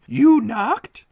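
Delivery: a tiny bent doorkeeper amused; odd little man. Acted. Small bent man at door.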